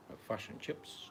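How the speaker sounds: fish and chips the Kiwi way, short and quiet; clipped Kiwi vowels, quiet; New Zealand accent. Shown as a demonstration. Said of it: "our New Zealand accent".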